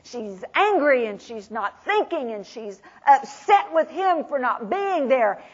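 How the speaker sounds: ordinary delivery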